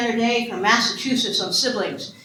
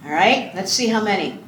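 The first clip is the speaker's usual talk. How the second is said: ordinary talk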